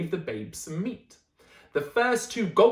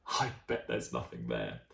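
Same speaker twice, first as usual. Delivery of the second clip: much lower, dismissive scoff; 7 dB quieter than usual. Acted. Dad scoffing at the water, very low and dismissive. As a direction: brush it off like a bossy dad. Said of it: "and scoffed".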